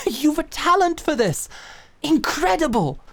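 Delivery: high laugh sliding into praise; delighted laugh. Acted. Mentor delighted by the princess's talent, laughing.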